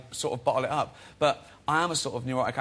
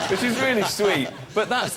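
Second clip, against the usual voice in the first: laughing; 8 semitones higher, 5 dB louder than usual. Real, not acted. Laughing while telling about his mum's remark. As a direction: still laughing, higher and louder.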